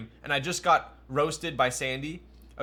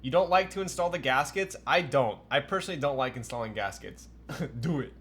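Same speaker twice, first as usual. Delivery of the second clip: ordinary delivery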